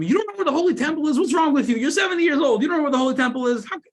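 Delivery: high, loud, fast incredulous yell; little kid yelling at a lost old man. Retold or imitated. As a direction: shriek at the old man in disbelief. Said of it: "The kid starts yelling".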